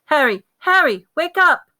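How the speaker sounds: high loud urgent calls; urgent calling. Acted. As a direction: shout to wake him. Lupin trying to wake Harry.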